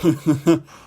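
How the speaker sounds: high, delighted laugh; amused laugh. Real, not acted. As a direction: burst out laughing at the discovery. Laughing at the diary discovery.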